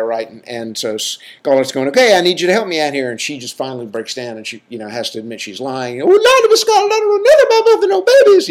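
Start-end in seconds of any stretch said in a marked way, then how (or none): 1.9-3.1 higher, louder, rushed demand; a hint of a pressed Southern belle; urgent Scarlett. Scarlett urging Prissy at delivery. 6.0-8.5 shrieking falsetto wail, very high and loud, rushed; hammed-up hysterical high-pitched meltdown; hissy fit. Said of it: "has this hissy fit".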